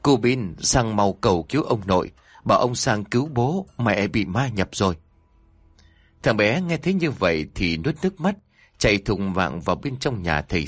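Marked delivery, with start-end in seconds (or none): none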